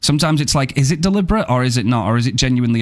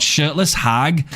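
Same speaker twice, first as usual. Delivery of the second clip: normal talking voice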